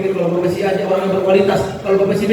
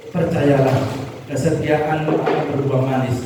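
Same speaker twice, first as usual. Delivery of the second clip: normal talking voice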